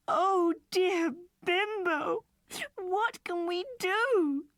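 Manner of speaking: high, very slow pleading; despairing child. A narrator's reading. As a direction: beg for help in distress.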